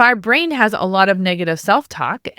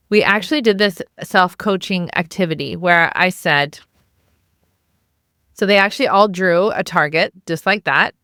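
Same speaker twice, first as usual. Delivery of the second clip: normal talking voice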